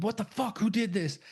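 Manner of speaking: acted indignant demand, pitched high; high mock outrage. [name] asking his friends who pranked him, mock outrage.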